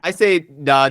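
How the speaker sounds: ordinary delivery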